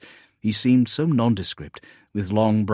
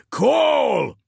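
high, loud, drawn-out call; urgent shout; 5 dB louder than usual. A narrator's reading. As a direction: yell the name across a battle. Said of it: "he shouted".

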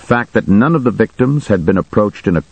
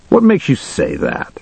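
much higher, sarcastic challenge; 10 semitones higher than usual. A narrator's reading. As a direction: needle with a high-pitched sarcastic question. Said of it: "one cop asked sarcastically".

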